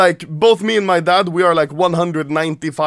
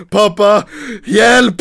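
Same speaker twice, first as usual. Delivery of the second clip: mild mock yell for help; 5 dB louder, slower than usual. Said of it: "I probably just screamed like".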